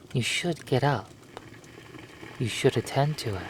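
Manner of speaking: ordinary delivery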